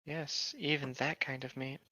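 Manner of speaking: soft, slow deadpan aside; quiet deadpan. Real, not acted. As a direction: slip it in softly and slowly.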